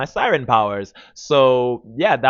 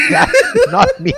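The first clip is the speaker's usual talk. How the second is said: high, loud, slow laughing denial; hammed-up prissy no; mock denial; 7 dB louder, slower than usual.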